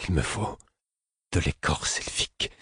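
careful, deliberate words; articulating carefully. A narrator's reading. Narrator to the fool, articulating carefully. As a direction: spell it out carefully, half-whispered. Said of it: "dis-je en articulant soigneusement".

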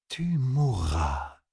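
solemn oracle woman. A narrator's reading. Woman warning Matt he will die, slow ominous oracle. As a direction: draw out the doom softly.

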